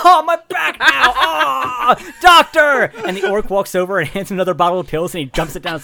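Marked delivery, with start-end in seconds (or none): 0.0-2.9 high, loud comic wailing; hammed-up pained cry. Knocked flat, the billionaire wails for his doctor, high and loud. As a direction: overplay the pain, calling for help. Bezos falls on back.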